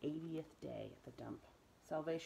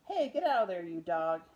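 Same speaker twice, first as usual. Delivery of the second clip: angry shout; 3 semitones higher, 13 dB louder than usual. Acted. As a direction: bellow him off the garbage. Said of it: "Ernest shouted".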